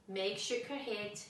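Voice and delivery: ordinary delivery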